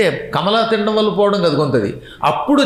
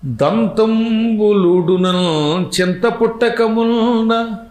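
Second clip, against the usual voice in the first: slow, drawn-out chanted verse, raised pitch; poem recitation; much slower than usual. Acted. Preacher recites the poet's verse to the audience, in slow chant. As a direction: intone each line slowly like a padyam.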